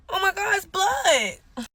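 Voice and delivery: high, drawn-out alarmed cry; shocked alarm. Retold or imitated. Woman discovering blood on her leg, shocked alarm.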